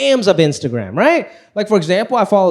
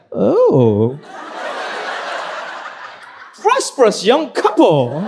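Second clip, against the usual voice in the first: put-on slow, stiff British accent; much slower than usual. Dad greeting son's girlfriend in British accent. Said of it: "came up with a British accent".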